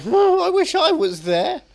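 high, slow swooning sigh; swooning wish. Retold or imitated. Friend swooning over the hot mum, high and slow. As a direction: melt with longing, way up high. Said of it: "And you're like".

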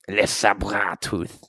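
slow, low, softened "Le sabre tooth"; "Le sabre tooth" with a throaty French r; French pronunciation. Acted. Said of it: "That is what we call him".

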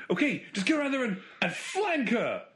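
hammed-up game character, words stretched high; Ghostbusters character impression. Quizzing friends on who am I, hammed-up high character voice. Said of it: "Who, who am I?".

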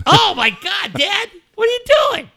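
loud, very high-pitched disbelief; mock-scandalized outcry, imitating a son; appalled son. Dad acting out his son scolding him for playing late, appalled.

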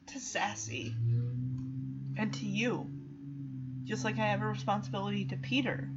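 low, hushed and heavy; soft, sorrowful retold speech; solemn guilt. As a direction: confess a failed duty quietly. Acting Shadow's solemn guilt.

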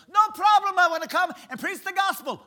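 very high, loud, bursting with excitement; excitable Brother Vic. Retold or imitated. Imitating Brother Vic on the phone, high and loud. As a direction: boom it out like the most excitable guy alive. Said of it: "the most excitable guy I ever met".

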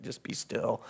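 softly whispered, almost no voice; hushed command. Retold or imitated. Jesus quietly commanding the storm, in a whisper. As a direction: hush the storm with a gentle whispered word.

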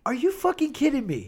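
high incredulous outburst; can't believe what he just heard. Real, not acted. Reacting to the claim the film is Kubrick's weakest, incredulous.

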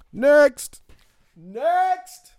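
loud, high squeal of next; calling next. Acted. Host skipping a song with a loud high cry. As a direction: shout it to skip the song.

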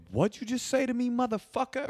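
high, squeaky mock challenge; tough teen challenge. J J confronting the kid in a mock high voice. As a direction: jeer it up high. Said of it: "J J goes".

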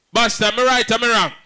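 high, loud call to the crowd; rally them with a question. Real, not acted. MC hyping the crowd, loud and high.

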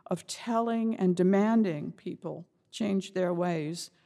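ordinary delivery

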